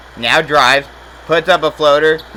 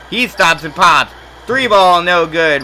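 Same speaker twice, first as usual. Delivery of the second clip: higher, louder shot call; excited call; 4 semitones higher, 5 dB louder than usual. Real, not acted. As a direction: rise with the shot. Three-point attempt call.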